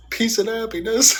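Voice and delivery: high, loud, wistful mock voice of the dad; imitating dad. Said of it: "he goes".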